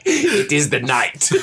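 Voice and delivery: mock ominous villain. Doing a dramatic villain voice.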